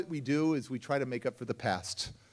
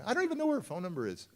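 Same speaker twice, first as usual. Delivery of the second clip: put-on fast, higher protest, like a kid making excuses; arguing back; 7 semitones higher, faster than usual. Arguing with the voice in his head, a quick high protest. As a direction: rattle off the excuse in a quick, raised voice. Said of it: "I'm arguing with the person in my brain".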